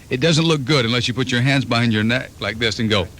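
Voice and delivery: ordinary delivery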